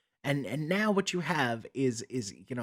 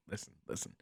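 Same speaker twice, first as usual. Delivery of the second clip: whisper; 16 dB quieter, much faster than usual. Real, not acted. Whispered aside to the audience.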